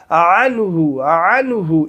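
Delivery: عنہ, voice swooping through a tight throat; correct ain. Shown as a demonstration. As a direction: model عنہ with pharyngeal ain. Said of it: "اس طریقے سے آواز نکالنی ہے".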